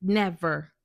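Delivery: usual speaking voice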